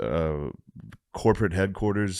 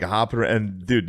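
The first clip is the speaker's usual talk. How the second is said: ordinary delivery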